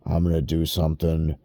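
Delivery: ordinary delivery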